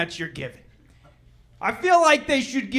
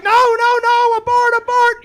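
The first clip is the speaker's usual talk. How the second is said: high, loud frantic no; frantic wife; 10 semitones higher, 4 dB louder than usual. Retold or imitated. Wife frantically stopping the husband, high and loud. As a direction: stop him in alarm, high.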